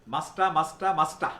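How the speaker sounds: মাস্টা said as one word, t flapped; pronounced masta. Shown as a demonstration. Teacher modelling মাস্টা for students. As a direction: run must have together as মাস্টা. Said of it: "Must have হবে কি?".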